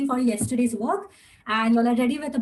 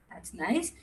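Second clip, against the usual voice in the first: normal talking voice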